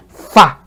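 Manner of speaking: "Fa" said low with the voice dropping; one low falling "fa". Shown as a demonstration. Teacher showing the word for pull, low tone. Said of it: "That's the word for pull".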